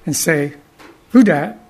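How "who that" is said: put-on higher, louder little-child question; curious toddler. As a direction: ask like a toddler pointing at a letter. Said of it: "I would point to a letter".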